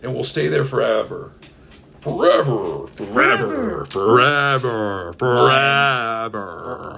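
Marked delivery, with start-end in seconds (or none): 2.0-2.9 echoing fade. Pretend. Echoing forever ending. 2.9-3.8 high echo. Pretend. Echoing forever ending. 3.9-7.0 drawn-out dying echo; slow fading echo. Pretend. Echoing forever ending.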